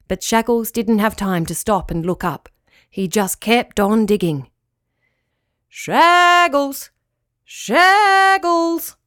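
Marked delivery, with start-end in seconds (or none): 5.7-8.9 high, loud drawn-out call; long searching shout into the distance; calling out loudly. A narrator's reading. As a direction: holler for a lost dog. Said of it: "called the voice, louder and louder".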